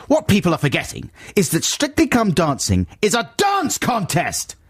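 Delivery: high-pitched, indignant rant; shouting letter-writer. Acted. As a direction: read it out like an outraged shouted complaint. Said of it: "shouting right the way through".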